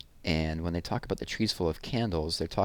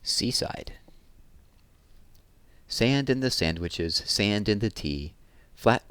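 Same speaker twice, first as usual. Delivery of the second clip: put-on lively, lilting read-aloud to children; poem reading; 4 semitones higher, 4 dB louder, slower than usual. A narrator's reading. Teacher reading a summer poem to children, lively and lilting. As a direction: savour each image, bright and rhythmic.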